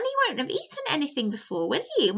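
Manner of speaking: plain reading voice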